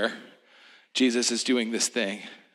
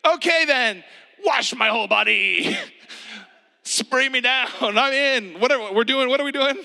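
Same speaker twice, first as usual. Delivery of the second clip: high, shouted, laughing mock enthusiasm; comic high shouting Peter; overeager Peter; 12 semitones higher, 5 dB louder than usual. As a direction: yell it like an all-in eager kid. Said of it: "And Peter's like".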